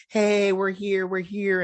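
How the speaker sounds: imitated high call to get attention; mock parents hailing their kid.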